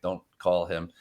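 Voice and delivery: ordinary delivery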